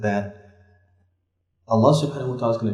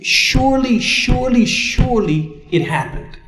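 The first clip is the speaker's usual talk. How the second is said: SURELY repeated high and punchy; emphatic insistence; 10 semitones higher than usual. Shown as a demonstration. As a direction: hit each SURELY hard and high, easing off on "it happened". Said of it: "When do we emphasize?".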